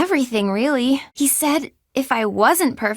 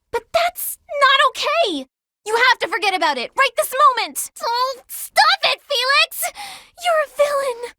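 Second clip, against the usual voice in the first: flustered pleading; 10 semitones higher than usual. Acted. Pleading Felix to forget.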